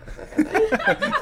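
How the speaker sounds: high giggling burst; high burst of laughter. Real, not acted. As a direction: laugh out at the joke. Laughing at the duck quack.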